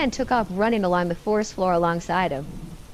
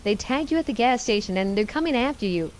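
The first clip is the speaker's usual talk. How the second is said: usual speaking voice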